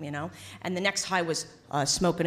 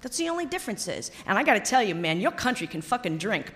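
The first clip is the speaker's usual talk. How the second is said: usual speaking voice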